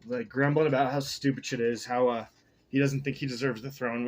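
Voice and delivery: usual speaking voice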